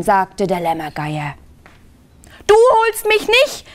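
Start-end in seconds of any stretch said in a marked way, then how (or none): 2.5-3.7 high-pitched storybook yell; child's defiant yell. A narrator's reading. Lieschen to the Lämmergeier in the park, high defiant yell. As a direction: yell it high, defying the vulture. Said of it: "schrie Lieschen Radieschen".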